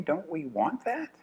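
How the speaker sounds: ordinary talk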